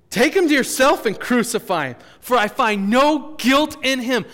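high, slowed, emphatic protest; forceful retort. Acted. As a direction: throw it back at the crowd loudly.

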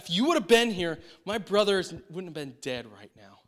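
acted upset complaint. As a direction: start high and loud, then let it sink. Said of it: "like she's upset".

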